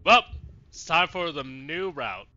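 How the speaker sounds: ordinary talk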